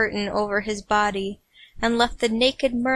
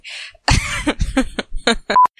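laughing; 3 semitones higher than usual. Real, not acted. Reader failing to read, giggling. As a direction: giggle breaking out. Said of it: "I can't talk today".

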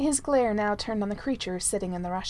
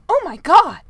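loud, high exclamation; astonished outburst; 8 semitones higher, 13 dB louder than usual. A narrator's reading. Manservant reacting late to a fire-breathing toad, astonished. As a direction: burst out, belatedly amazed.

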